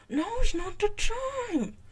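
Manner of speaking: slow, loud, high-pitched garble; struggling to speak. A narrator's reading. Scully rebuffs the sheriff with mouth jammed open, struggling to form words. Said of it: "struggling to fi-, form words".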